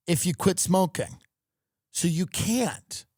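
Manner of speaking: ordinary delivery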